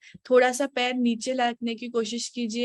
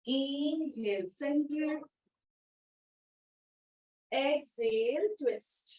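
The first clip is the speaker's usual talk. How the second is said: slow demo cue; 3 semitones higher, 6 dB quieter, much slower than usual. Shown as a demonstration. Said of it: "मैं और एक बार दिखाती हूँ, मेरे साथ कीजिए".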